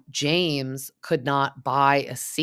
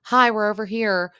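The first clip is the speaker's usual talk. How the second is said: high and loud, calling across the airport; mock airport greeting; cheery call-out; 8 semitones higher, 7 dB louder than usual. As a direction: wave them over with a bright hi. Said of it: "Sheena's like".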